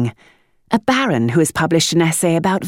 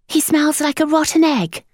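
put-on much higher child's voice; blunt boy; 13 semitones higher than usual. A narrator's reading. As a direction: flatly declare it. Boy elaborating.

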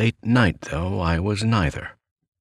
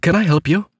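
higher, louder polite offer; feigned nonchalance; 11 semitones higher, 6 dB louder, faster than usual. A narrator's reading. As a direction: control the fear and sound casual. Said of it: "as nonchalantly as possible".

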